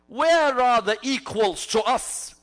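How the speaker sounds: loud, slow, imperious retold challenge; arrogant challenge. Preacher voices a warrior calling out for equals, arrogant challenge. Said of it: "Came forward full of arrogance".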